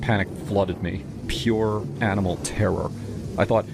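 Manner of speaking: plain reading voice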